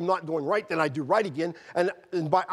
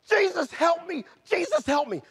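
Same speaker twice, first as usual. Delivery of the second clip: high-pitched, desperate cry for help; urgent retold scream, pitched way up; re-enacted screaming; 13 semitones higher, 4 dB louder than usual. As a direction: cry out as if trapped in the ditch. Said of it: "I screamed that in that ditch".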